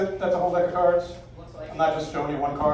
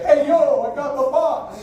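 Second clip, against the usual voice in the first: loud, raised New York thug voice; New York gangster; 7 semitones higher, 4 dB louder, slower than usual. Shown as a demonstration. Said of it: "in your best New York gangster style voice".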